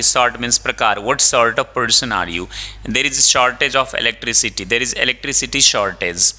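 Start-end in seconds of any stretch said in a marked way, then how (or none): none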